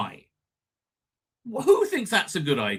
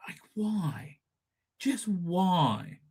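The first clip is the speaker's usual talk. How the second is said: breathless unvoiced whisper; whispered why; 7 dB quieter, much slower than usual. Real, not acted. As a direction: drop to a despairing whisper. Exasperated whisper aside.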